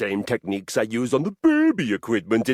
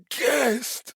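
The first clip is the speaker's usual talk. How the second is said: incredulous. Acted. Outraged at becoming a guest.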